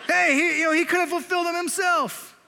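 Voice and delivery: loud, high New York heckler; hammed-up New York accent, calling out an objection. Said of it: "like, a New York accent".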